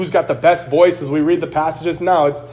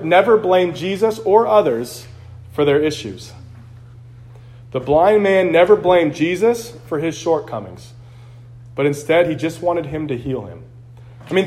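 usual speaking voice

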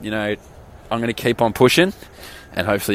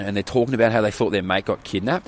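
ordinary talk

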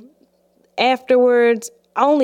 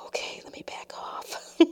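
whispered to herself, much softer; calming whisper; 18 dB quieter than usual. Acted. Telling herself to back off from the argument, whispered. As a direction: whisper it to cool yourself down. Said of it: "Let me calm down".

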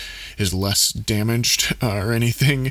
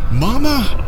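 very high frightened scream; desperate scream; 13 semitones higher, 4 dB louder than usual. Acted. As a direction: scream for mama again. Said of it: "Ezra screamed again".

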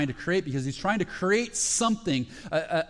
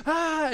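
cheering cry; 11 semitones higher than usual. Retold or imitated. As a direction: let out a cheer like the whole plane. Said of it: "the whole plane erupts in cheering".

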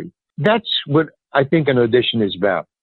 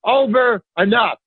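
hammed-up frantic outburst at high pitch; imitating a scream; 9 semitones higher than usual. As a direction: act out a director losing it, pitch up high. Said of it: "throwing his hands up and screaming".

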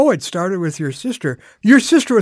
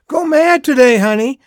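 higher, louder send-off; calling out; 5 semitones higher, 5 dB louder than usual. Retold or imitated. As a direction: re-enact a dad calling after his child. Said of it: "I'm going".

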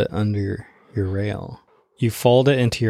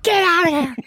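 high-pitched yell to chase someone away; alarmed high yelp; retold shout. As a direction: holler it way up high. Retold yell, first reaction.

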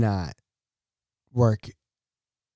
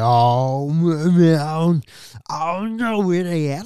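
mock innocent coworker covering up, drawn louder; smirking feigned ignorance; 4 semitones higher, 6 dB louder, much slower than usual. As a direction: play dumb with a smirk, voice rising. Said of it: "they start smirking like".

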